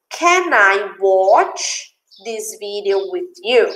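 slow, spaced-out question for learners; slow model reading. Shown as a demonstration. Teacher repeats dad's question for students, slow model reading. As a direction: draw the question out word by word.

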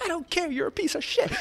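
high-pitched imitation of a furious girlfriend; mock angry retort. Girlfriend rejects boyfriend's promises, angrily. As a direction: spit back a hammed-up insult.

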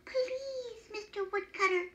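high-pitched courteous elf plea; very polite. A narrator's reading. Elf begs the woodcutter, very politely. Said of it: "very politely".

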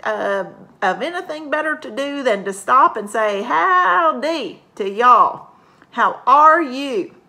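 a hint of a hearty farmer saying howdy; Farmer Brown puppet. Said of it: "Farmer Brown".

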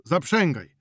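high, loud command; urgent order. Acted. Prokop ordering Witalis to harness horses, loud command. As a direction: bark the order.

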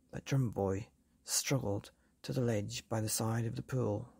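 ordinary delivery, nothing marked

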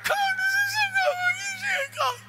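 very high, loud wailing; acted mad crying. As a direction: bawl the words in a hammed-up crying squeal. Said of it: "And our cry is mad".

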